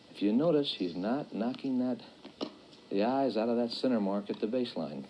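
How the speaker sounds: low-pitched, measured courtside commentary; live demonstration commentary. Real, not acted. Coach commenting on Dorsey's practice shots, low-key commentary. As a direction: keep it low, observing shot by shot.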